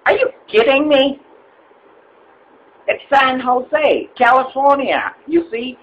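high, disbelieving exasperation; can't believe this guy; exasperated. Acted. Caller exasperated at the agent, high and incredulous.

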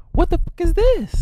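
loud, very high-pitched disbelief; shocked outburst. Acted. Walking in on a friend tied up, shocked outburst. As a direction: burst out in alarm at the sight.

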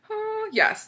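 high excited squeal; delighted high yes. Real, not acted. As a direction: squeal it with joy.

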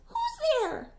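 small high squeak, a touch startled; startled mouse. A narrator's reading. Mouse hears someone at the den, squeaks high. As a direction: cry out like a tiny nervous mouse. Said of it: "Mouse cries".

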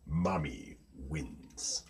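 very soft, low and slow; hushed final verdict. A narrator's reading. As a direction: let the winner land slowly and softly. Narrator declares the final winner.

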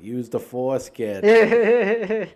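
put-on thick Boston accent, kid jumps high and loud; heavy Boston accent, Star Wars line. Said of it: "quoting Star Wars, like, in a Boston accent".

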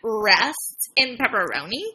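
slow, low, drawn-out joke farewell; slow mock solemnity. Host repeats a joke farewell for effect, slow mock solemnity.

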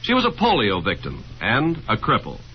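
ordinary talk, nothing marked

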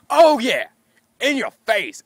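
loud high triumphant jeer; retold gloating yell. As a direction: gloat at the game. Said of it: "they hear me just go".

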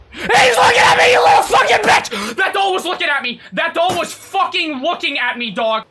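high, loud panicked shrieking; terrified scream. Real, not acted. Streamer seeing a doll stare at them, terrified scream. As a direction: scream in alarm, easing slightly toward the end. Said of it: "I'm actually scared".

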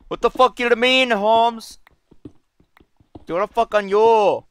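rough, high-pitched stab at an Arabian accent; loosely faked Arabian accent; attempted Arabian accent. Shown as a demonstration. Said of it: "an Arabian, uh, accent".

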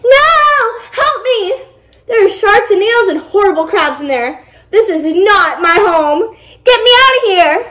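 high-pitched panicked plea, not a full scream; 惊慌呼救. A narrator's reading. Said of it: "screamed Aqua".

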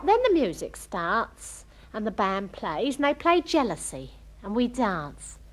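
normal talking voice

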